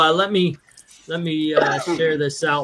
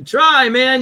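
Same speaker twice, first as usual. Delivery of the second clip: mock taunt; 9 semitones higher, 4 dB louder than usual. Taunting friend to take control.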